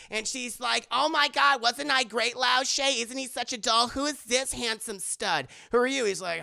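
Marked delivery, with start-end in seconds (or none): 0.9-6.1 higher, louder airhead gushing; ditzy Willie. Retold or imitated. Willie fawning over Indy, high and gushing. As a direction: flirt like a vain showgirl.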